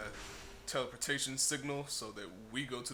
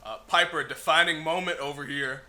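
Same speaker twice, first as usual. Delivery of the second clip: louder, higher call across the room; 6 semitones higher, 12 dB louder than usual. Real, not acted. Streamer calling his companion Piper over to watch, loud call. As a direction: shout over to get her attention.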